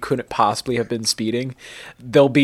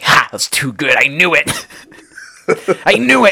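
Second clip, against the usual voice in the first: triumphant laughing gotcha. Pretend. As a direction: crow it out like you finally caught him. Catching Arnold faking the accent.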